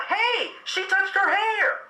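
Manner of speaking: excited gotcha. Real, not acted. Gleeful gotcha.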